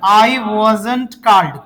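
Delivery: very slow, word by word; slow model sentence. Shown as a demonstration. Teacher to his class, dictating the answer very slowly. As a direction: drag each word out for repeating. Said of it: "बोलिए".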